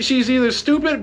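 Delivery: normal talking voice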